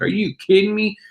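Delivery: can't believe the gain; disbelief. Retold or imitated. Patron hearing about the profit, disbelieving. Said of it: "And like".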